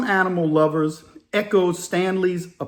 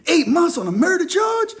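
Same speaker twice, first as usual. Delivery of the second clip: shocked disbelief; 12 semitones higher than usual. Retold or imitated. Fellow inmate disbelieving the eight-month murder sentence, shocked squeal.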